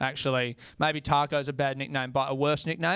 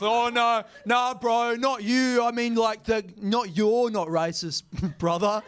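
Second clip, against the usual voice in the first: higher, flustered mock apology; backpedalling bro; 6 semitones higher, 3 dB louder, slower than usual. Bro backing off from the comic, flustered mock voice. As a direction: hurry to take it back, hammed up. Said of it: "Then they back off".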